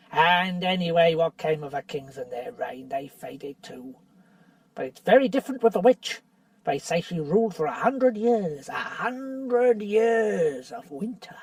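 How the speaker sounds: lightly voiced bitter dwarf; sneering dwarf. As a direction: needle Caspian with rising scorn. Nickabrick argues for the witch.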